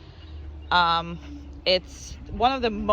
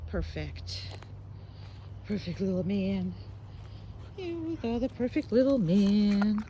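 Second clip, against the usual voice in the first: tender praise; 4 dB quieter than usual. Real, not acted. Trainer praising dog softly.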